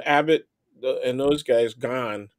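ordinary talk

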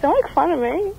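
high squeaky echo of the caller; high mocking mimic. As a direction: parrot her line back, high and mocking. Mimics caller's line back.

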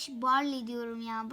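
normal talking voice

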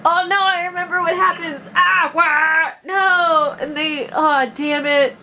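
high, dragged-out wail of dismay; real dismay. Real, not acted. Player remembering what happens next, real high-pitched dismay. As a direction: cry out high as the memory hits.

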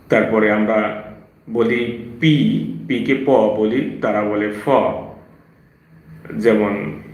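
usual speaking voice

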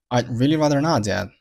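"I'd really rather not, Dad" with stops held back on "I'd", "not", "Dad"; unreleased final stops. Shown as a demonstration. Teacher models "I'd really rather not, Dad" with unreleased endings. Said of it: "爆破音都没有爆出来".

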